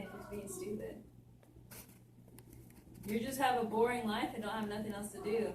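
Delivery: usual speaking voice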